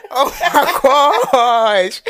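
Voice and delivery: high, loud laughter through the words; giggling hard while talking; laughing. Real, not acted. Laughing imagining baby crying on podcast.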